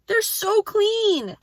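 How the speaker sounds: stretched out slow and high, overjoyed; delighted. Real, not acted. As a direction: savour every word of it. Delighted about her clean car.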